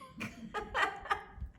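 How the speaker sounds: short breathy laugh. Real, not acted. Laughs at memory.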